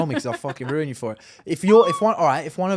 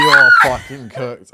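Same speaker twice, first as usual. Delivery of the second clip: slow, loud, drawn-out verdict; loud exasperation; 11 dB louder, slower than usual. Real, not acted. Host repeating verdict over co-host's protest, loud exasperation. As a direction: belt it out, stretching each word.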